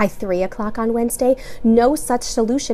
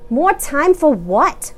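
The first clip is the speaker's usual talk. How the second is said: demanding; 6 semitones higher, 5 dB louder, slower than usual. A narrator's reading. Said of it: "Missus Pompaday demanded".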